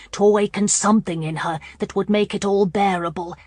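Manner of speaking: plain reading voice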